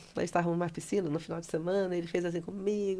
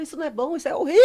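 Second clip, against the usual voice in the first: high wail building to a shout; hammed-up despair, rising to a loud cry; mock-dramatic outburst; 8 semitones higher, 5 dB louder, much faster than usual. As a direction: blow up on the last word. Acted dramatic outburst in retold call.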